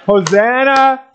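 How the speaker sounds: high call, short of full shout; bold call. Shown as a demonstration. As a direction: call it out high, holding some back. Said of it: "say it a little bit louder".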